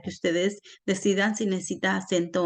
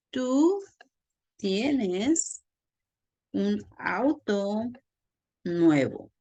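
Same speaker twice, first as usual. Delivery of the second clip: word by word, with long pauses; slow dictation; much slower than usual. Shown as a demonstration. Teacher giving an example of tú with accent, slow dictation. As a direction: spell out the sentence one word at a time.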